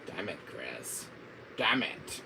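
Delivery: hushed villain cursing; slow, low mock frustration; Wesker. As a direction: curse softly as a thwarted villain. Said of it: "got a Wesker for you".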